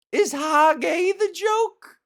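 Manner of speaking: a high, sneering echo of a bad joke; mocking disbelief. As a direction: squeak it out in disbelief.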